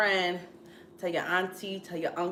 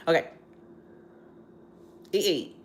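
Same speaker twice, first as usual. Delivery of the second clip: ordinary talk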